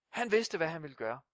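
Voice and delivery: usual speaking voice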